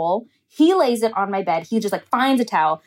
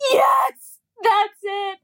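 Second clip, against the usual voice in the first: much higher, teary cry of relief; relieved excited cry; 8 semitones higher, slower than usual. Retold or imitated. To the man who found the cup, crying out in relief. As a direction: cry out yes, high and overjoyed.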